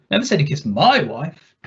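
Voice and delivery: my punched with a rising tune; stress on my. Shown as a demonstration. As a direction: lean on my. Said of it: "see how the meaning shifts with a different emphasis".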